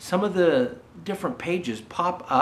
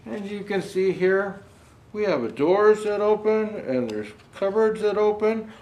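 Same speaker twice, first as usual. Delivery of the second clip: ordinary delivery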